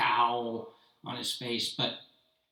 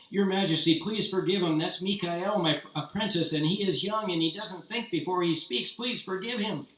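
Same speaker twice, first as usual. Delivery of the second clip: pleading master; 6 semitones higher, 4 dB louder than usual. Acted. Master begs the Tsar.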